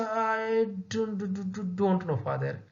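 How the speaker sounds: frightened, stammering; fearful stutter. Retold or imitated. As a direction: stammer it out in fear. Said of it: "in a frightening, in a fearful manner".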